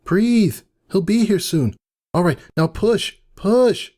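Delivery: high coaxing voice, pressing harder; urging midwife. Acted. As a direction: push her on, pitched high. Cirrus coaching the birth.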